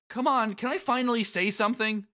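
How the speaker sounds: high, exasperated hero voice; Sora. Acted. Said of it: "Sora says".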